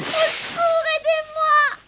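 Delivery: high, crying plea for help; calling for help. Acted. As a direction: beg to be saved.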